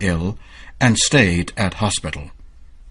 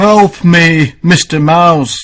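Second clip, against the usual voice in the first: higher and much louder call for help; a trapped lion crying out for help; pleading lion; 8 semitones higher, 7 dB louder, slower than usual. A narrator's reading. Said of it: "The lion sees the mouse and says".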